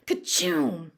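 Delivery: loud fake sneezes; mock sneeze. Joe acts Billy sneezing, mock sneeze. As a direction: play a big mock sneeze.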